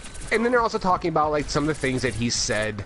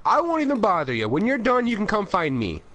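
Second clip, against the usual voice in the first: high, breezy salesman; brush-off salesman tone; 7 semitones higher than usual. Retold or imitated. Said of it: "And he goes".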